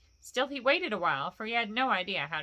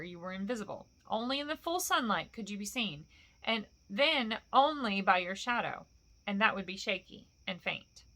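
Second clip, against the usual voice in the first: ordinary delivery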